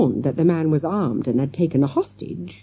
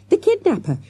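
very high, urgent calls; shouted; 14 semitones higher, 3 dB louder than usual. A narrator's reading. Girl alerting classmates to the man, shouted. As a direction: raise the alarm, calling high to each friend. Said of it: "she shouted".

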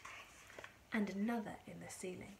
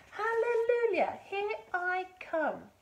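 high, loud, drawn-out crow of triumph; exultant shout; 11 semitones higher, 9 dB louder, slower than usual. A narrator's reading. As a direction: holler it slowly as you rise. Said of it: "she shouted, finding her voice".

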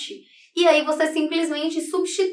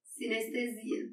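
"Sinestesia" said once more, much softer, half to herself; quiet repeat; 2 semitones lower, 14 dB quieter, slower than usual. Shown as a demonstration. Teacher softly repeating the corrected word to herself. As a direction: murmur "Sinestesia" as a check of what was written. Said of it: "Gente, eu escrevi sinestésica".

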